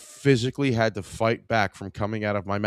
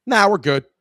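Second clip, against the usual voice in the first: high, louder dopey nah; dopey customer refusal; 9 semitones higher, 8 dB louder than usual. Retold or imitated. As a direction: blurt the refusal pitched up and loud.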